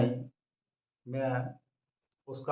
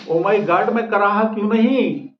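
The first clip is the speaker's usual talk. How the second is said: higher and louder comic alarm; mock panic; 4 semitones higher, 7 dB louder than usual. Husband panics to his wife about his fever, in mock alarm. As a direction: cry it out like a melodramatic patient.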